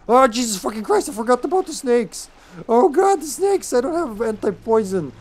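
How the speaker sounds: loud, high-pitched alarmed outburst; panicked rush of exclamations, voice jumping up; startled panic. Real, not acted. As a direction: yelp in alarm at a sudden scare. Startled by snakes in the game.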